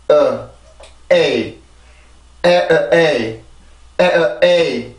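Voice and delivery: vowels 에, 어, 에이 spaced out with pauses, stress on 에; teacher chanting the vowel beats 에 어 에이; rhythm drill. Shown as a demonstration. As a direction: tap out the vowel rhythm 에, 어, 에이 slowly. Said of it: "리듬을 먼저 만듭니다".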